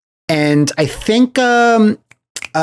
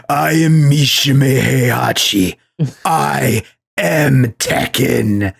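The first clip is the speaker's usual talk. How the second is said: slow, grave mock Japanese pronunciation; dramatic villain line; slower than usual. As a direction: declaim it like a proud patriarch. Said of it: "He's like".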